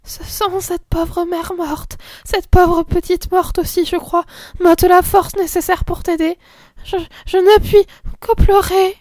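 put-on high, slow little girl close to tears; a frightened child near tears; faint, trembling voice. A narrator's reading. Madeleine refuses to help her sister, faint and tearful. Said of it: "d'une voix faible et tremblante".

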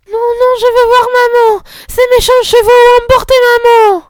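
put-on a frightened small child crying out. A narrator's reading. The little girl wakes and calls her mother, crying out.

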